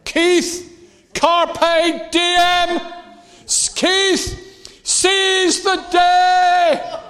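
loud, very high, drawn-out shout; shouted exhortation. Real, not acted. Preacher calling Keith out to seize the day, shouted exhortation. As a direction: call it out to one person in the crowd.

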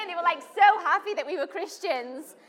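high, loud happy narration; excited retelling of joy; excited telling. Real, not acted. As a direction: tell it bubbling over. Describing shared joy.

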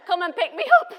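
high, loud laughing demand; high laughing mock plea. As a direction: beg through laughter. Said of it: "She's like".

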